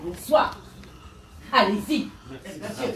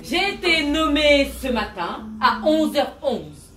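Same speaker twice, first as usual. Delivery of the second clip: ordinary delivery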